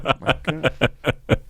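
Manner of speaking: laughing disbelief. Retold or imitated. Stunned reaction to the insult.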